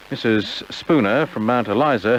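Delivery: usual speaking voice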